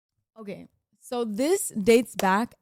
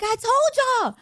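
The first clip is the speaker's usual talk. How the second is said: high, loud gloating; triumphant I-told-you-so; 14 semitones higher, 5 dB louder, slower than usual. Retold or imitated. Telling everybody she was right, high loud gloat. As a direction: crow it like you were right all along. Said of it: "I'm telling everybody".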